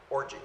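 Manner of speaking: "Orgy" said OR-jee, English way. Shown as a demonstration. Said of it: "Or what would we say?". ("Orgy" pronounced OR-jee)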